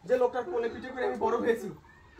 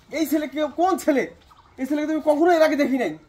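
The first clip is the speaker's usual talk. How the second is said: louder, slow, accusing demand; angry questioning; 4 semitones higher, 8 dB louder than usual. Acted. Husband confronts his wife about the strange young man, angry and louder. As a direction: pin her down with each word.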